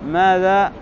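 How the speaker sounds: ordinary talk, nothing marked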